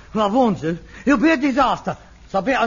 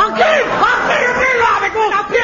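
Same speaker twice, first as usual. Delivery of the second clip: higher, louder repeated threat; furious shout; 12 semitones higher, 5 dB louder than usual. Real, not acted. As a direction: explode at the father's interruption. Son furious at singing father.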